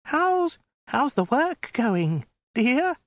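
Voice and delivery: very high, slow falsetto with a pout; falter, then finish on a pathetic pout; miserable pouting yelp. A narrator's reading. Captive woman trying affection for Khan, miserable pouting yelp. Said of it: "with a kind of pathetic pout".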